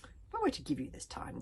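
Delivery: grudging dragon consideration; lightly growled offer; growling dragon. A narrator's reading. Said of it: "growled the Dragon Furious".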